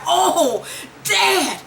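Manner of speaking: high, desperate cries to Dad; rising, breaking plea that ends in a shout; panicked pleading son. Retold or imitated. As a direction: beg the father who just shot you. Voicing shot son pleading with father.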